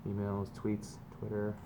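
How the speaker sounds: normal talking voice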